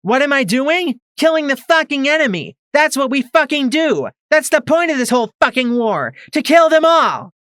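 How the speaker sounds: high, loud furious yell. A narrator's reading. Mare yelling at Twist about the war, furious. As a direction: rage at her, every word a shout. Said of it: "the mare yelled at Twist".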